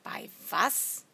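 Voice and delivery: hushed start leaping up on the last word; puzzled, sharply rising question; incredulous query. A narrator's reading. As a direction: ask as if you can't believe it. Asks to hear the answer again.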